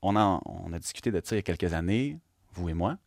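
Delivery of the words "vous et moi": usual speaking voice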